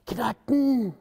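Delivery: usual speaking voice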